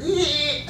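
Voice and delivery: high, soft drawn-out squeak; imitated squeak. Preacher imitating his money leaving the wallet, squeaky. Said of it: "squeaking as it comes out my wallet".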